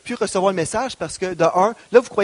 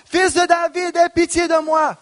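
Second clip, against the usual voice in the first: much higher, desperate shout; crying louder; 10 semitones higher than usual. Acted. Beggar shouting over those silencing him, desperate. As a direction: cry out even harder over the crowd. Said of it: "il criait beaucoup plus fort".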